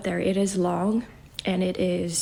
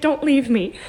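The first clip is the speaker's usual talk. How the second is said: higher, louder plea of a clingy being; half-acted plea, higher and louder; pleading don't leave me; 7 semitones higher, 7 dB louder than usual. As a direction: beg like something that won't let go. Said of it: "type feeling".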